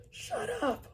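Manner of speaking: sharp high shout. A narrator's reading. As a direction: snap it out as a yell. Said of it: "I shouted".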